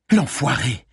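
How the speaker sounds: mild burst of swearing; angry curse. A narrator's reading. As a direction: curse with a touch of anger. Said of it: "jura Malines".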